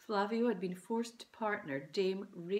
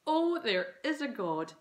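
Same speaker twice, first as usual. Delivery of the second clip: relieved exclamation; 5 semitones higher, 7 dB louder than usual. A narrator's reading. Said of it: "exclaimed Dad".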